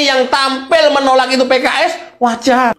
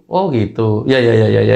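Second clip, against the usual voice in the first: ordinary delivery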